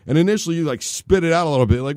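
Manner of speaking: normal talking voice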